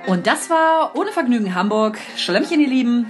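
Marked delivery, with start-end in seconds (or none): none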